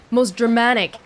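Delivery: normal talking voice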